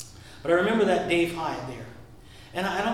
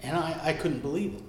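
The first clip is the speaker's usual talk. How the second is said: usual speaking voice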